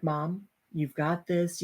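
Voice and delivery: soft, slow encouragement; steady her with a hushed, unhurried voice; quiet reassurance. Retold or imitated. Daughter encouraging her panicking mom, quiet reassurance.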